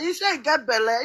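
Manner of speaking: normal talking voice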